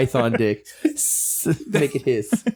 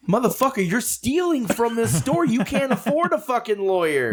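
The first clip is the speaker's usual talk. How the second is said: mocking retort; 9 semitones higher than usual. Mocking a shoplifter's threat.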